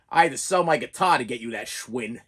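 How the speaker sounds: guilt-trip in a low grumble; father reminding son what he sacrificed, low; low dad voice. Retold or imitated.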